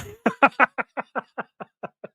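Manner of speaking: long, high laughing fit; helpless laughter. Real, not acted. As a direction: break up laughing mid-sentence. Breaks up over Jerry's face.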